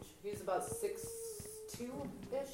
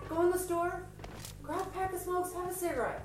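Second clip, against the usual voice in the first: much higher, sing-song suggestion; mock-helpful advice; 10 semitones higher than usual. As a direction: sweetly suggest a smoke break. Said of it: "And then I told him".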